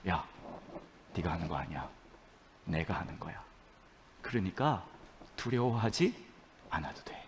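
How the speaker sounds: soft, low voice of God; God's quiet reassurance. Acted. God telling a fearful believer not to fear, quiet reassurance. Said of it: "그때 하나님 그러시는 거죠".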